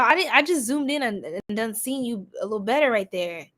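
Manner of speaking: ordinary talk, nothing marked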